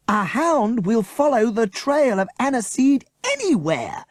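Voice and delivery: slow, high, loud group shout; children screaming. A narrator's reading. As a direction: scream it out together in realisation. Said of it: "screamed the children".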